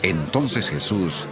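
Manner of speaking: ordinary talk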